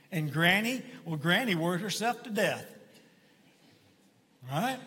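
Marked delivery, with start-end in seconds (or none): none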